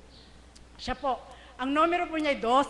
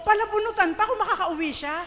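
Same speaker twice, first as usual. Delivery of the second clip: normal talking voice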